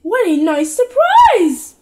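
hammed-up delight; pleased surprise. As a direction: gush at the surprise visit. Mother greets visitors.

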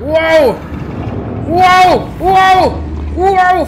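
high, very loud yells; startled shouting. Real, not acted. Player facing a giant rearing beast, startled shouts. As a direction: yell out in shock, high and loud.

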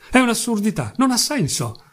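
high-pitched indignant rebuttal; agitated protest. A narrator's reading. Accused Mitya rejects the record, agitated protest. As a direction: throw the charge back in agitation.

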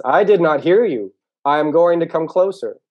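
loud, high, drawn-out shouting; calling out. Acted. Turtle who cannot hear the mole, calling out. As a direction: shout slowly as if across a distance.

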